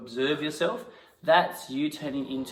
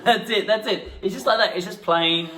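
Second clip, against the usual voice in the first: laughing praise; 7 semitones higher, 6 dB louder than usual. Real, not acted.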